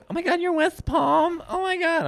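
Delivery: very high, squealed girl impression; hammed-up, high-pitched gushing. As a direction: mimic excited fans way up in falsetto. Mimicking girls gushing at him.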